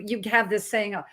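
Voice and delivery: normal talking voice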